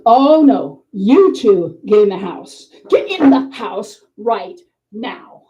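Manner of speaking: loud, slow, stern order; mock-angry McCoy. [name] ordering two costumed women indoors, mock-angry. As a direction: play a put-on scolding with drawn-out words. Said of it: "he's pulling a Mad McCoy thing on us".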